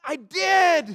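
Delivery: loud, high cry of regret; anguished admission. Acted. Preacher acting out facing his regrets, loud pained admission. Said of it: "And you're like".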